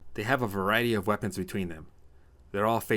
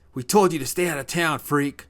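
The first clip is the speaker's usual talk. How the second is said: loud, high-pitched taunt; bullying teen; 8 semitones higher, 9 dB louder than usual. Acted. Village teen corners Tommy in alley, bullying. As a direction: jeer loudly at your victim.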